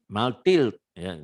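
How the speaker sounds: usual speaking voice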